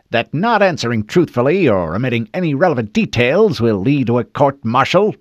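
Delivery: loud, firm threat of court-martial; stern officer warning. Acted. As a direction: warn him with authority. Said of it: "the first male voice said".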